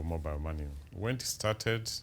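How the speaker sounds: ordinary talk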